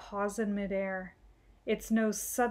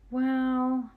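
doubtful; 4 semitones higher, 5 dB louder than usual. Acted. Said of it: "said Brother doubtfully".

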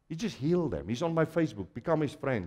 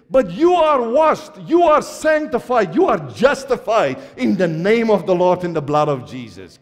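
fervent preaching shout; 5 semitones higher, 8 dB louder than usual. Acted. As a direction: proclaim it over the church. Preaching Paul's words at full force.

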